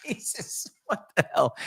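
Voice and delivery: breathy laughing exclamation; laughing dad. Retold or imitated. Dad sees son in hockey gear, laughing in disbelief. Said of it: "He burst out laughing".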